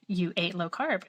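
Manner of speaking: ordinary delivery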